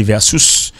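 "versus" said the Latin way, "VER-sous"; Latin pronunciation. Shown as a demonstration. Teacher contrasting Latin with English, "versus" said "ver-sous". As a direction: give "versus" an oo vowel in its second syllable. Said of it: "on dit en latin".

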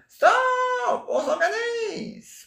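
very high, drawn-out squealed cry; mock cry. Quoting a journalist calling for order, high mock cry. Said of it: "Cria le journaliste".

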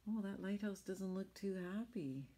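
ordinary delivery, nothing marked

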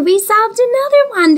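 high, loud delighted praise; celebrating a solved puzzle; excited cheer. Real, not acted. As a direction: cheer them on brightly. Cheering the class.

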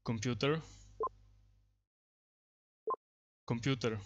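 projecting a command at the microphone; loud voice command. Real, not acted. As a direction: call it out crisply so the software picks it up. Commands spoken to speech recognition.

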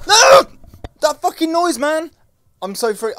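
very high, loud panicked yelling; scream, then protest frantically; freaking out. Real, not acted. Player startled by that noise, freaking out loudly. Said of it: "I'm freaking out".

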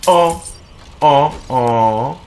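usual speaking voice

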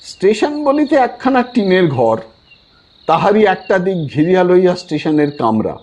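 ordinary delivery, nothing marked

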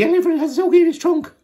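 high, loud, fast odd voice; very strange voice. Acted. Joe reports the elephant, very high strange voice. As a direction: make it sound truly strange. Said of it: "in a very strange voice".

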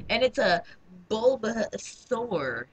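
"Bulbasaur" very slow and drawn out; dramatic reveal. Pretend. Player revealing what hatched from egg, slow and drawn out. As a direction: stretch "Bulbasaur" out for a grand reveal.

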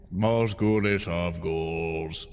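low, slow ghoulish intoning; spooky voice. Pretend. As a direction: draw it out like a Halloween ghoul.

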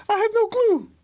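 high carefree answer to a student's question; cheerful admission. Retold or imitated. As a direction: toss it off lightly, unbothered. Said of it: "I go".

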